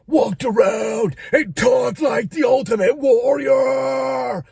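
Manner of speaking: high, loud wrestler shout; hammed-up wrestler promo roar; Ultimate Warrior voice. Shown as a demonstration. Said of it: "I did this voice and stuff".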